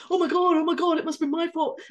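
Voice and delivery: mock panic. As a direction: play the colleague spiralling into self-blame. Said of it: "into the defensive".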